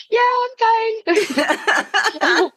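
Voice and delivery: high, flustered fake calm. Starstruck fan answering the actor, high and flustered. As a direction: squeak out that you're fine.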